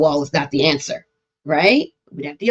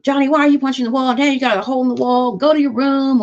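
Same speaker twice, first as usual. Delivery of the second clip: a mildly scolding parent, a bit higher; 7 semitones higher than usual. Retold or imitated. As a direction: nag Johnny like a cross parent. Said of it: "Like scolding of some sort".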